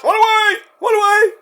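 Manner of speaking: high mock panicked shout; run away. Wrestling fan mocking a scared wrestler, high panicked yelling. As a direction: squeal it like fleeing in terror.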